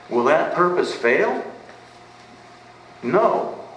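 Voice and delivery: rising question then a high, ringing No; preacher drives home the answer with a lifted, emphatic voice. Real, not acted. Preacher answering his congregation, emphatic and high. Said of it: "There is an emphatic no there".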